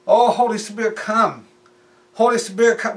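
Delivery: high, loud imitation of imploring prayer; mock fervent invocation. Preacher imitating people asking the Spirit to come, mock fervent invocation. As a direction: call out with put-on desperation. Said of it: "to mock you, make fun of you".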